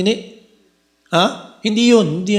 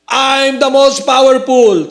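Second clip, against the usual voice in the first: slow, higher, louder bragging; boastful claim; 7 semitones higher, 4 dB louder, slower than usual. Retold or imitated. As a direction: crow it like a proud strongman. Mocking a boastful person. (mayabang na pagmamalaki, mabagal)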